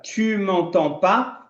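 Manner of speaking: slow, careful French phrase; slow French model. Shown as a demonstration. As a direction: say it slowly for students to repeat. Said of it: "pronuncia comigo". (frase em francês dita bem devagar)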